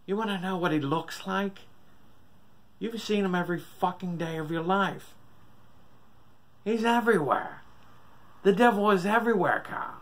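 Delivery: high frantic raving scream; rising to a frenzied scream. A narrator's reading. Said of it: "hear his father screaming".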